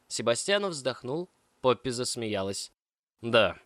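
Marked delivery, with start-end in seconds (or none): 3.2-3.6 a louder, curt yes; gruff correction. A narrator's reading. Sebastiano overruling Poppy to the gondolier, curt and louder. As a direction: firmly overrule her. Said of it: "грубо поправил он".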